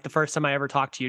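ordinary talk

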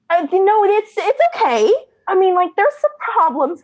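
high-pitched, hesitant self-reassurance; trying to stay positive. Retold or imitated. Moviegoer desperately trying to like the film, high and hesitant. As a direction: talk yourself into liking it, voice up high. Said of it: "I really desperately tried".

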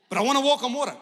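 high, loud mock pleading; eager pleading. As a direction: play an eager, insistent believer. Acts out eager would-be water walker.

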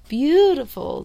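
"beautiful" swelling high and loud; emphatic repeat. Real, not acted. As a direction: lean hard into BEAUTIFUL, pitch arching up then falling.

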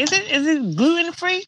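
high-pitched, drawn-out mock question; mock worried eater. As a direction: imitate her asking, very high and slow. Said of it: "She's like, she's like".

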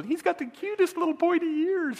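high, gushing swoon in a put-on voice; smitten high schooler. Preacher imitating a smitten high school boy, high gushing coo. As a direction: coo it like a lovestruck teen. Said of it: "In the high school".

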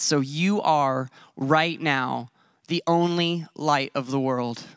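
So you are right now the only light, drawn out slowly; slow, weighty phrasing of the whole line; slow emphatic phrase. Shown as a demonstration. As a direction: stretch every word to make it urgent. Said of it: "which is present tense".

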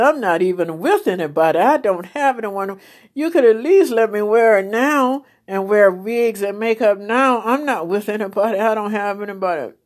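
fast, loud complaint; pleading complaint. Retold or imitated. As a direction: raise the voice, pleading and aggrieved. Complaining to God.

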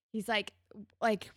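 normal talking voice

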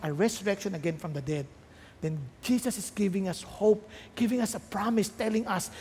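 normal talking voice

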